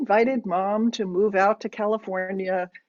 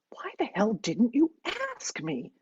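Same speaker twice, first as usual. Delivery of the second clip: mild, high-pitched outrage without shouting; hissed-in exasperation, high but held down; inner scream; 7 semitones higher than usual. A narrator's reading. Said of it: "the one screaming in my head".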